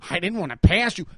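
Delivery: a mock meek cyclist voice, lightly put on. Mimicking the cyclist's excuse to his face, mock meek. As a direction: parrot his excuse back at him. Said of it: "be like".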